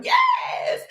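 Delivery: very high squeal of yes; excited shout. Real, not acted. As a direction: squeal it with joy.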